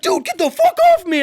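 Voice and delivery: high, loud recoil; panicked bully. Retold or imitated. The bully recoiling: high, loud panicked protest. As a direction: back away in alarm. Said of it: "he's like".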